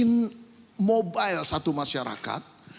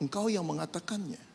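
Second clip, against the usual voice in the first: calm, soft reply; Jesus answering Pilate, calm and soft; 8 dB quieter than usual. A narrator's reading. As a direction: answer quietly, unshaken. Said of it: "Dengan tenang dia menjawab".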